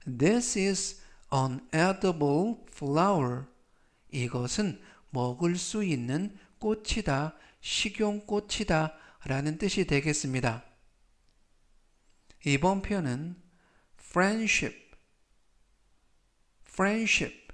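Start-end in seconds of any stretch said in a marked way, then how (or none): 0.1-3.5 "A vegetarian doesn't eat meat" read very slowly, word by word; slow model reading. Shown as a demonstration. Teacher reads the example sentence slowly to students. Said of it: "즉, 전체적 의미는". 14.0-17.5 "friendship" with the d barely sounded, close to "frenship"; soft d in friendship. Shown as a demonstration. Teacher shows learners how "friendship" drops its d. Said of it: "friendship라고 발음하시면 되겠고요".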